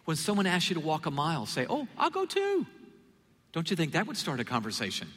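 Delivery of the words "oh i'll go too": much higher, chirpy offer; mock cheerful offer. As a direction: sing out a bright, eager volunteer. Cheerful response to walking a mile.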